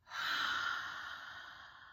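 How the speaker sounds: long breathy blow-out exhale; dragon breath exhale. Shown as a demonstration. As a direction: blow out slowly like breathing fire. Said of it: "like you're blowing out fire".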